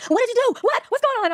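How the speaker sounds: high, panicked mom yelling; frantic hollering. Retold or imitated. Mom hollering at her pregnant daughter, frantic high yell. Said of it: "she was hollering".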